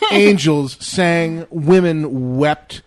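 slow, loud, mock epic proclamation; mock grandeur. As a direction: intone it like a legend.

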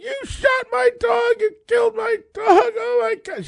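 shrieking high and loud, sobbing through it; a distraught owner screaming over her dead dog; frantic crying shout. Retold or imitated. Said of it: "She's flipping out".

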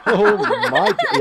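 high, helpless laughing; real laughter. Real, not acted. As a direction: crack up so the words break off. Cracks up at the death joke.

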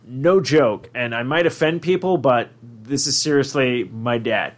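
usual speaking voice

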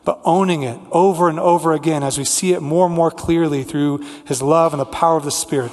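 ordinary talk, nothing marked

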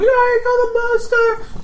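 high, loud, slow cheer; orc cheer. Acted. GM's orcs cheering the player's monster kill. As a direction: whoop like a happy orc.

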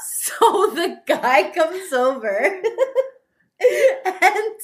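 giggling through the words; laughing so hard she can barely talk. Real, not acted. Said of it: "Kat is laughing so hard right now".

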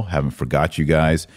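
usual speaking voice

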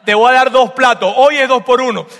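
loud, high market-seller call; street vendor pitch. Acted. Jacob hawks lentils to Esau, loud vendor call. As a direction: sell it like a street vendor shouting a deal.

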